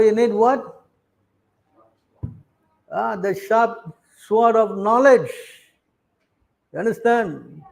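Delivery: ordinary delivery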